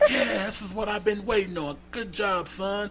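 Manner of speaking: high, soft mock-delighted approval; imagined delighted dad. Suitor imagines her dad's approval, high mock-delighted voice. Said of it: "he was just gonna be like".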